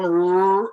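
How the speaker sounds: Rrr held as a long growled r; teacher modeling the Rrr sound; r sound demo. Shown as a demonstration. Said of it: "but I'm going".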